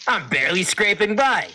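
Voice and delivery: high, loud merchant voice; shopkeeper voice. Acted. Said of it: "He says".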